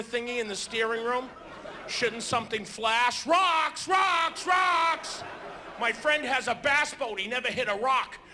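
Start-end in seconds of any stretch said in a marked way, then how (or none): 3.2-5.2 high shouted alarm, rocks rocks rocks. Pretend. Mock alarm on a ship bridge, shouted high. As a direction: yell it like a warning siren going off.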